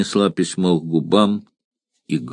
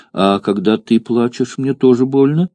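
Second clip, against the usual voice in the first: put-on little girl; 4 semitones higher than usual. Child asks mother.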